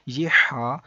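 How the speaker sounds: ہا pushed out loud from the middle of the throat. Shown as a demonstration. Teacher shows where ہا is made, loud throaty ہا. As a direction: breathe ہا out hard from mid-throat. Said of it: "حلق کے درمیان والے حصے سے ادا ہوتا ہے".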